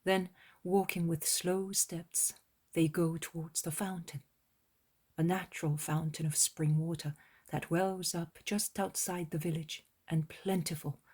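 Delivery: ordinary delivery, nothing marked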